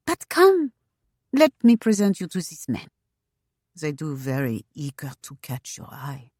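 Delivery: put-on lively accented hostess; vivacious French wife. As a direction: coax brightly in a French accent, ending confidentially. Femi's accented voice in story.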